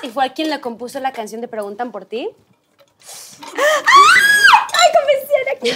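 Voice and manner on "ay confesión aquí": high, loud squeal of a confession; excited shout. Real, not acted. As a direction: cry it out, much higher. Reveals a secret, shouts.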